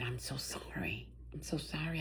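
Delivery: soft breathy whisper, barely voiced; tearful whispered apology; sorry whisper. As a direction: hush it like a nurse breaking bad news. Her eyes got watery.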